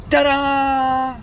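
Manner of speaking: high, loud triumphant reveal; excited ta-da. Real, not acted. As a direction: announce it like a magic trick. Reveals the toy inside egg.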